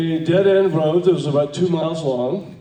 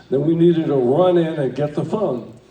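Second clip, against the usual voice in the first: ordinary talk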